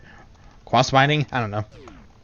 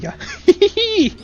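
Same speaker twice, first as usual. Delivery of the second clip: very high, squealing giggle; gleeful laugh. Real, not acted. Gamer having fun after a leap, gleeful laugh.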